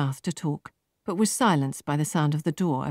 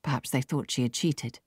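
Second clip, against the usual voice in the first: plain reading voice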